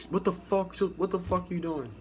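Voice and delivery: imitating an officer yelling; mock petty officer yell. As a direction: berate the recruit like an angry petty officer. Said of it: "like that".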